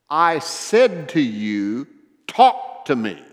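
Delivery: slow, loud, insistent scolding; retold indignant demand. Wheelchair lady confronting passing preacher, slow indignant demand. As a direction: act out her demand, hitting each phrase.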